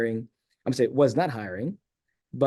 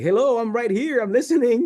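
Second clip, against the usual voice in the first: indignant protest; 11 semitones higher, 5 dB louder than usual. Retold or imitated. Protesting being talked past.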